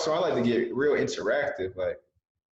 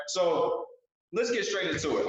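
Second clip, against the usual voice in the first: normal talking voice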